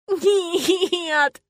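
high, drawn-out howl; long whining cry; wailing denial. A narrator's reading. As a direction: wail it in misery. Heroine howls her denial again.

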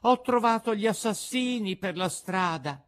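high, loud puppet outburst; alarmed puppet. A narrator's reading. Puppet tells companions of assassins, high alarm. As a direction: announce it loudly as the puppet.

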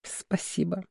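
barely voiced, tender whisper; soft whisper. A narrator's reading. Girlfriend thanking her fiancé for the gift, in a whisper. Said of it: "тихо прошептала".

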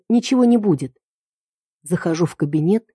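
ordinary delivery

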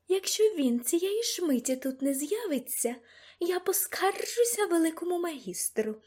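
high-pitched declaration of outrage; indignant threat. A narrator's reading. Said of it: "заявила мадам Россіні".

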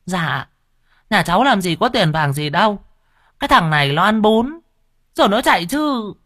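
high, humble, fretful woman's voice; pleading old seller. Acted. Noodle seller explaining to the official, high and humble.

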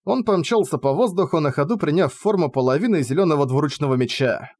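usual speaking voice

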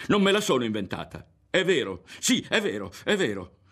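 high, loud, insistent protest; overwrought. A narrator's reading. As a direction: insist as if swept away. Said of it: "una ventata lo sconvolse".

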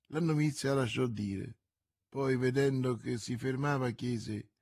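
plain reading voice

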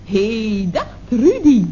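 ordinary talk

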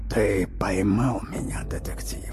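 rustle it like dry leaves; sinister whisper. Acted. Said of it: "зловещий шёпот из другого мира".

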